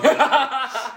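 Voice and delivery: high laughing voice. Real, not acted. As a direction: laugh through the words. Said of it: "Oh, I'm choking up".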